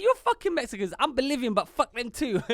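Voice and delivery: high, loud mocking rant; hammed-up furious local. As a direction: spit it out like an angry bigot. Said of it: "I was like".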